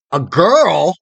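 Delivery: loud, offended outburst; indignant shout. A narrator's reading. Boy indignant at being called a girl, shouting. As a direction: exclaim it in affronted wrath. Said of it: "shouted Photogen".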